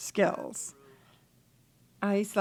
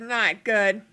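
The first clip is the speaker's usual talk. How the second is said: normal talking voice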